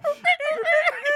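squealing burst of laughter; high shrieking laugh. Real, not acted. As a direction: let out a shrill laugh.

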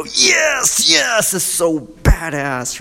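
high, shouted fan cheer; mock diehard fan yelling with joy; excited fan shout. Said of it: "all the diehard fans go".

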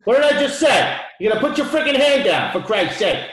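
high, loud exasperated shouting; angry scolding. Retold or imitated. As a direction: shout down someone who won't listen. Acted scolding, angry shouted quote.

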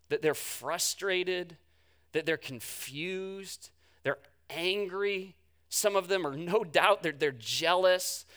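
ordinary delivery

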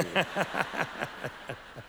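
a real laugh at his own joke; amused laugh. Real, not acted. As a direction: let out a warm laugh.

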